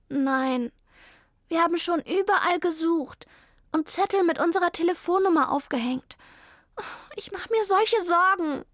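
put-on a sad kid who lost the cat; worried child.

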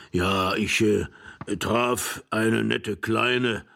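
put-on halting, drawn-out old man's voice; forgetful old man. Forgetful old husband starting a story for his young wife, halting. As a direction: ramble slowly, searching for the words.

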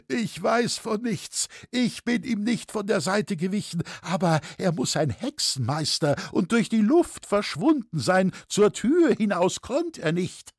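high-pitched frightened whine; whimpering self-defence. A narrator's reading. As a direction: plead innocence in a high voice. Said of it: "wimmerte der Halberwürgte".

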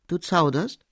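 a sharp demand from the master. A narrator's reading. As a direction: snap the question at him. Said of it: "Fuhr er nun fort mit scharfem Ton".

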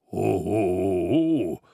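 a startled Santa laugh; Mister Xmas caught off guard, a mild surprised ho ho ho. A narrator's reading. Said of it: "schien ehrlich überrascht".

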